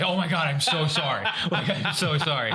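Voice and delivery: put-on high, loud panicked kid apologizing; frantic apology. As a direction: gush out the apology like a scared kid. Kid apologizing after shooting ref.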